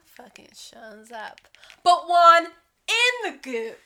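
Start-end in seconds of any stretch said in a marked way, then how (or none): 1.8-3.8 loud, high and slow announcement; excited. Real, not acted. Telling friend who shows up at the game, excited. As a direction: announce it with glee.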